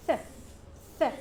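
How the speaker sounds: "th" as a short, isolated voiceless sound; soft TH sound. Shown as a demonstration. Teacher showing TH before I, soft voiceless "th". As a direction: say "th" alone with no voicing. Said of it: "we pronounce it as".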